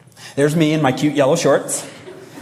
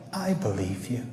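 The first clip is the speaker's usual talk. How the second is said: very soft imitation of a worried wife; hushed mock farewell; 3 semitones lower, 11 dB quieter than usual. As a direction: say it faintly, as if humoring a doomed husband. Wife's doubtful farewell, acted.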